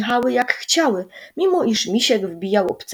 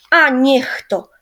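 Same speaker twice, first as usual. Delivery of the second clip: higher, louder annoyed cry; boy crying out after the crash, higher and louder; exclaiming; 5 semitones higher, 5 dB louder, slower than usual. A narrator's reading. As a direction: curse the spill. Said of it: "krzyknął Misiek".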